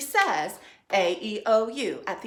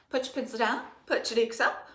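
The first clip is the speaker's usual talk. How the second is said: ordinary delivery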